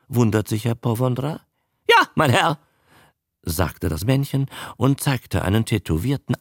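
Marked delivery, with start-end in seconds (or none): 1.9-2.6 eager showman. Acted.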